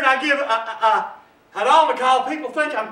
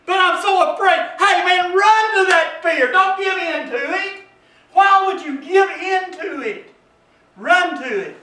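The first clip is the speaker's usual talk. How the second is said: higher, urgent preacher's delivery; preaching at full force; 8 semitones higher, 7 dB louder than usual. Real, not acted. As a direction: urge the congregation on.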